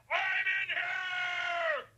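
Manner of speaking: very high, drawn-out scream for help; long, loud cry of a trapped man; desperate shout. Acted. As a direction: shout slowly to be heard from far off. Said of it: "I shouted until I was hoarse".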